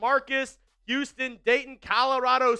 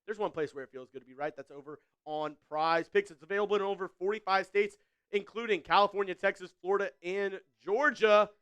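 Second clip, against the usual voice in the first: quick, polished sponsor read; fast ad read; 3 semitones lower, 3 dB quieter, faster than usual. Acted. Streamer reading a sponsor spot to viewers, fast ad voice. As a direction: rattle off the ad like a pitchman.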